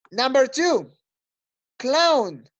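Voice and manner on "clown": "clown" drawn out with a falling tune; stretched, falling "clown" for the class to copy; pronunciation model. Shown as a demonstration. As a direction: model "clown" carefully for learners. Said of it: "Las voy a pronunciar yo".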